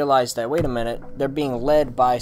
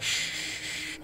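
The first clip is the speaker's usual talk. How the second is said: hushed "Shh" then a creepy lilt; creepy killer; 3 semitones higher than usual. Retold or imitated. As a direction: lure the boy in like a sinister host. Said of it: "being all creepy and shit".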